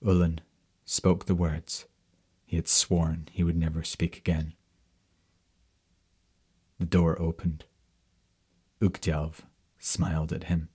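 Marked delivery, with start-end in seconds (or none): none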